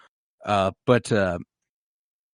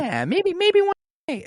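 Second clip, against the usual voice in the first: airy, much higher, dreamy smiling voice; a lovestruck girl smiling as she answers; smiley. Retold or imitated.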